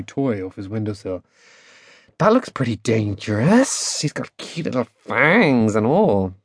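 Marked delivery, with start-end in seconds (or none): 2.2-6.3 slow, drawn-out teasing lilt. A narrator's reading. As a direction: stretch the words out as playful mockery. Girl teases boy about cuddly toy.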